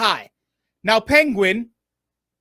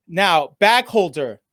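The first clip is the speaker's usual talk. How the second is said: deliberately slow; 5 semitones lower, slower than usual. Shown as a demonstration. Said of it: "obviously I'm speaking very slowly".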